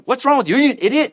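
very high, heated insult; exasperated, high-pitched attack; angry questioning. Acted. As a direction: confront them in acted outrage. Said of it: "angry content".

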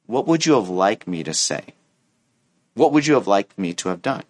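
low, measured reply; composed, constructive asking; calm questioning. Acted. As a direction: answer the outburst calmly. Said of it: "ask a good question".

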